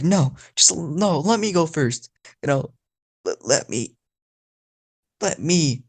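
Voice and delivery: high, pleading little sister; little girl voice. Acted. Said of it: "a little girl accent".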